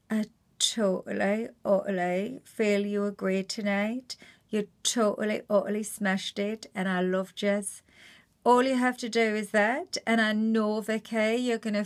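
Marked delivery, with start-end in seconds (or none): 0.1-7.8 easy, gentle Geordie praise. Shown as a demonstration. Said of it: "imagine you look like her and you're saying".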